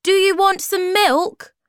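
high, loud offer called out; high-pitched calling; shout. A narrator's reading. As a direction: shout the question to someone out of sight. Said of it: "he shouted".

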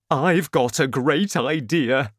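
loud-edged, eager announcement; excited father. A narrator's reading. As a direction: burst out with a big idea. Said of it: "he was very excited".

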